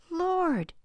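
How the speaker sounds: joyful cry. A narrator's reading. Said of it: "they cried".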